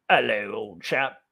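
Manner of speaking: a deliberately bad, hammed-up British accent. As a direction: play a cartoon British gent in a bad British accent. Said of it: "doing the bad British accents".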